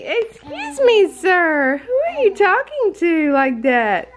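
put-on high-pitched baby-talk indignation; playful mock offence. Mom answering her yelling baby, playful mock offence. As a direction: pretend to be affronted, pitched high and drawn out.

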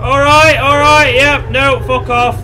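loud, high-pitched alarm; panicked refusal. Real, not acted. Player recoiling from the creature, panicked loud voice. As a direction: back off fast, loudly refusing.

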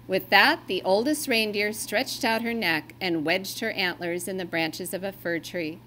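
ordinary delivery

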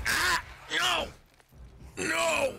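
sudden high yelps of alarm; high startled cries mid-fight; panicked yells. Real, not acted. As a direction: yelp out in panic.

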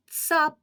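Цап set apart by pauses; one-syllable beat. Shown as a demonstration. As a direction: say Цап alone, crisply, as a single syllable. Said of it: "Скільки разів відкриємо ротик?".

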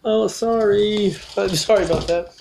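high, put-on sorry voice; apologizing clown. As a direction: plead sorry in a comic high voice. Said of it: "of course, apologize".

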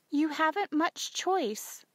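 slow, high-pitched motherly reply; unyielding mother. Acted. Mother answers wailing daughter.

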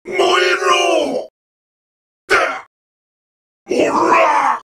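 high, forceful attack shouts; battle cry. Acted. Villain burning his foe, high battle shouts. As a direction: hurl each cry with the blow.

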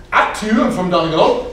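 lightly acted Irish accent; a lilting Irish voice, mildly hammed-up. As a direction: play an amazed Irish barfly. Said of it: "a little bit of an Irish accent".

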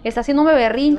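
high, pushy fake-mother voice; lying stranger. Kidnapper telling the helper to move aside, high and pushy. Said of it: "decía".

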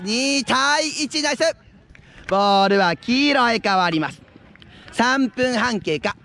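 ordinary talk, nothing marked

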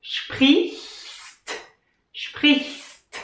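sprichst hissed, ch and st crisp; sprichst again. Shown as a demonstration. Teacher drilling sprichst for learners. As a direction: repeat sprichst slowly and crisp. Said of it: "So you have spri".

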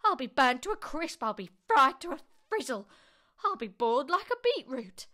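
high, loud frantic wailing; yelled. A narrator's reading. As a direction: yell in mounting alarm. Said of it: "she yelled".

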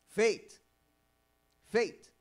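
loud, firm single word; emphatic answer. Real, not acted. Preacher answering his own question, loud and firm. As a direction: ring it out.

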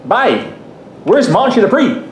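put-on high-pitched, rough stab at a Newfoundland accent. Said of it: "I can't do a Newfoundland accent".

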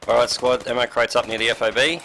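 loud call to the squad. Real, not acted. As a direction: raise your voice across the base. Calling out to whole squad.

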